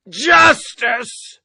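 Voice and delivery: nearly yelling it; shouted word. Acted. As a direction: blurt the word out loud and high. Cadet blurts the word out.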